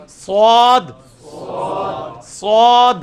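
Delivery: سواد with a thick, heavy s, held long and loud; drawn-out سواد, full-mouthed whistling s; heavy emphatic sad. Shown as a demonstration. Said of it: "اس کو موٹا کر کے پڑھنا ہے".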